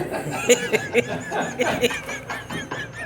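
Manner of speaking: breathy laugh at his own joke; laughing at his own dark joke. Real, not acted. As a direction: crack up at the punchline.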